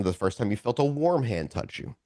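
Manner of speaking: ordinary delivery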